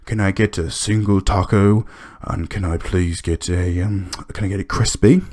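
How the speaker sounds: lightly voiced picky old customer; a hint of a fussy old man ordering; imitating old man. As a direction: mimic a fussy regular ordering, only a little changed. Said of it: "one of those guys that gets, like".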